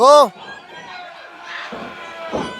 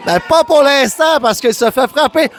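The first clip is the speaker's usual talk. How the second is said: usual speaking voice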